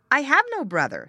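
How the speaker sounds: put-on high, loud child's protest. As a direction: cry out like a small child.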